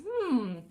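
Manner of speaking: loud buzzing hum while chewing. Shown as a demonstration. Coach humming and chewing for vibration. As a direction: hum and chew gently. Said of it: "we're gonna hum and chew".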